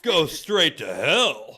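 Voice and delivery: loud, slow hammed-up hero line; action movie hero. Playing Jesus in his action movie version, loud and slow. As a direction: punch out a big action-movie one-liner. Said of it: "In my action movie version of this".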